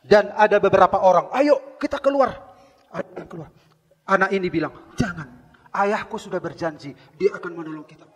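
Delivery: loud fervent sermon voice; high, loud pulpit delivery; preaching at full force. Acted. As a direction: preach the story to the back row.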